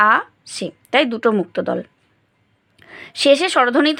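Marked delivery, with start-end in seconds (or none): 0.1-0.7 "আ সে" split by a pause; syllable by syllable. Shown as a demonstration. Teacher demonstrating each part, "আ সে" syllable by syllable. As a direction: give "আ" and "সে" one beat each. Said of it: "আমরা এক একবারে উচ্চারণ করছি".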